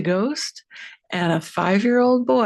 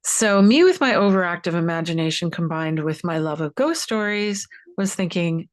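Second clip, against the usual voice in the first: ordinary delivery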